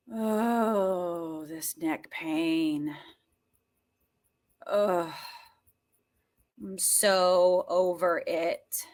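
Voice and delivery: low, soft, drawn-out moan; slow, weary and quiet; weary groaning complaint. Shown as a demonstration. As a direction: groan it slowly, worn out. Groaning about neck pain in exercise.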